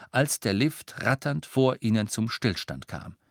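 ordinary delivery

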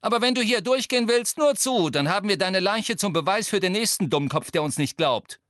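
loud high irritated warning; annoyed. Acted. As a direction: scare them off with annoyance. Ginny keeps scaring off the crowd.

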